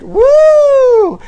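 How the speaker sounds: loud high whoop; shrieking celebratory woo; pride whoop. Acted. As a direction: let out a full party scream. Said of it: "Whenever you say Pride, you have to go".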